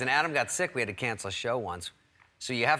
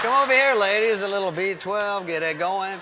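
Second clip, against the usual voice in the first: high, loud hype-man holler; mock party call; 9 semitones higher, 7 dB louder than usual. Old guys luring ladies with B twelve, mock hollering. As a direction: call the room over, easing off at the end.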